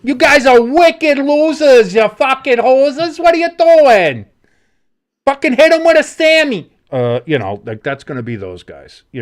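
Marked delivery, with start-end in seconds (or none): 0.0-6.7 high, loud, drawn-out Boston heckling; hammed-up drunken Bostonian holler. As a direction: yell it slowly like a drunk Boston fan. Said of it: "a drunk Bostonian that goes".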